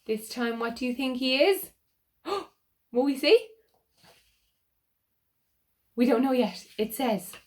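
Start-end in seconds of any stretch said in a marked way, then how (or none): 2.0-3.5 gasp, then ask higher and louder; sharp breath of suspense, quick question; excited gasp. Real, not acted. Reading to children, a gasp of excited suspense. Reader gasps at the page turn.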